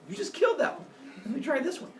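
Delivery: high, eager mock kid voice; gleeful kid. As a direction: lightly play a careless kid.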